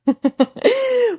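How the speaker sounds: high burst of giggling; real high-pitched laugh; giggle. Real, not acted. Amused at what the iron rod reminds her of, giggle.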